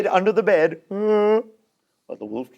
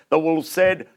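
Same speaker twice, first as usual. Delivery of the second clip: usual speaking voice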